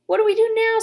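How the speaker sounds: high, loud urgent question; eager and pressing. Acted.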